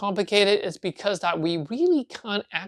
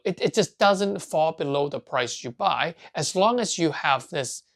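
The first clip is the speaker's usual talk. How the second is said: ordinary talk, nothing marked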